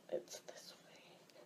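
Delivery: slow, very soft whisper; whispered aside. Real, not acted. Vlogger checking herself between takes, whispered aside. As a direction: whisper it to yourself.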